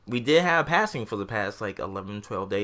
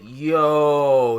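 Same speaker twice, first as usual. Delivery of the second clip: long, loud, raised yo; retold astonishment; 4 semitones higher, 6 dB louder than usual. Coworker reading the news on his phone, astonished. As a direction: draw out a stunned "yo".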